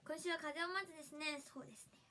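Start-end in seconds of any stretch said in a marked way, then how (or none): none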